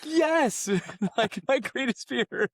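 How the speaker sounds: high, laughing and slow on my greatest fear; laughing disbelief. Real, not acted. Laughing about own greatest fear.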